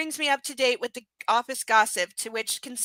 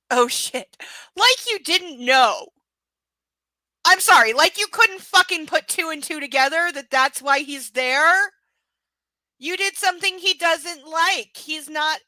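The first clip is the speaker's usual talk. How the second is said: exasperated rant; 7 semitones higher, 9 dB louder than usual. Real, not acted. Ranting at the book's heroine.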